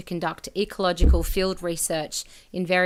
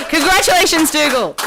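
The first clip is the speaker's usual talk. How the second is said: laughing through the word, louder; 9 semitones higher, 11 dB louder than usual. Real, not acted. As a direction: congratulate while still laughing. Laughing while congratulating winner.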